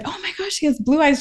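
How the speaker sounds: put-on high, delighted gasp of a small child; childlike wonder. Little sister seeing the baby's eyes, high childish wonder. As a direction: gasp it like a thrilled three year old. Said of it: "I said".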